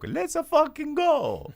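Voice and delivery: put-on high, slow Italian Mario accent; drawn-out Mario cheer, Italian accent; Mario catchphrase. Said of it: "this episode's dedicated to Jacopo".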